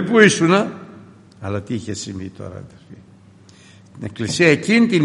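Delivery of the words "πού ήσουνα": high, loud accusing question; angry mother. Retold or imitated. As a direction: demand where he was. Mother waiting outside. (η μάνα ρωτά θυμωμένα τον γιο, ψηλά)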